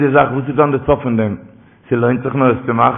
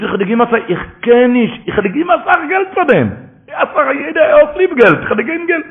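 sustained high, insistent voice; high-pitched protest; 10 semitones higher than usual. Acted. Preacher acting out the money argument, high and insistent. Acted pleading over money.

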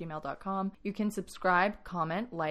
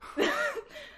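genuine squeal laugh. Real, not acted. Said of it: "That sound I just made was so genuine".

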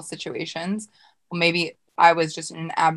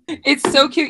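ordinary talk

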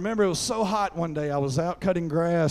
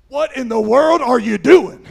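loud, higher exasperated yell; dad's scolding shout; 6 semitones higher, 12 dB louder than usual. Retold or imitated. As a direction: imitate an alarmed father yelling at his kid. Dad scolding after the rescue.

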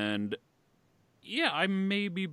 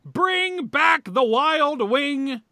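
slow, loud, high hype announcement; announcer voice; 8 dB louder, much slower than usual. Pretend. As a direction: announce it like a sportscaster. Announcing the first jersey.

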